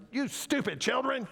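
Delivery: high-pitched mock scolding; mock mad God. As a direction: play an angry God telling off the kids. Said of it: "It's not that he's mad".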